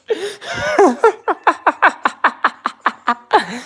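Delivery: bright squealing laughter; high giggling laugh. Real, not acted. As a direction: laugh hard at a silly memory. Laughing at the skit.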